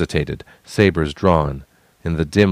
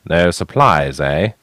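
deadpan; 3 dB louder, slower than usual. A narrator's reading. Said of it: "Asnai deadpanned".

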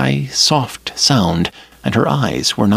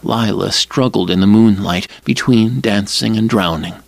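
ordinary delivery, nothing marked

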